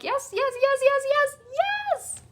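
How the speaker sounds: giddy, breathless yes-yes-yes building up; ecstatic yes. Shown as a demonstration. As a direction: squeal each yes with rising joy. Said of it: "have you ever felt that energy of".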